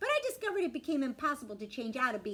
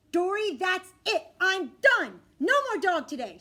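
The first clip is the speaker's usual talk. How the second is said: loud, high, emphatic mom at her limit; angry snap; 8 semitones higher, 11 dB louder than usual. Acted. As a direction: snap at the child, slowly and loudly. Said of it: "she snaps at me from the front seat".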